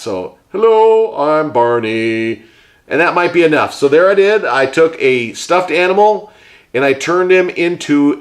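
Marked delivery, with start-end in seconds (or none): 0.5-2.4 Barney voice. Pretend. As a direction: greet kids as a slow cheery dinosaur. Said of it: "talking like that".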